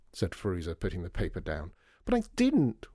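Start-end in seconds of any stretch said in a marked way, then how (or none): 2.0-2.9 high, loud wailing protest. A narrator's reading. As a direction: wail it out in protest. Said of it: "wailed Lydia".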